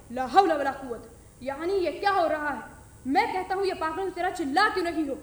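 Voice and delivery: loud, bewildered angry demand; father demanding what is going on; exasperated outburst. Acted. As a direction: bellow in disbelief at the chaos. Father bursts in, gun in hand.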